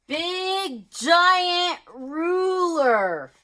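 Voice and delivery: high, slow, hammed-up; big reveal. Shown as a demonstration. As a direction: stretch out big giant ruler in a silly high voice.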